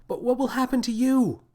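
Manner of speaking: high-pitched anxious cry; faltering, desperate high question. A narrator's reading. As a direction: call out in distress, pitch way up. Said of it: "Her voice faltered as she cried out".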